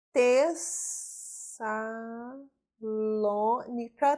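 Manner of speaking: "Tessalônica" stretched very slowly, syllable by syllable; very slow teaching enunciation of "Tessalônica"; drawn out by syllable. Shown as a demonstration. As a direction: sound out "Tessalônica" in long, separate syllables. Teacher sounding out a long word.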